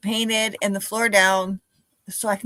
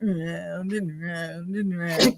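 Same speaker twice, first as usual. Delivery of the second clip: slow and drawn out; 3 semitones lower, 3 dB quieter, much slower than usual. Acted. Mimicking circular painting motion.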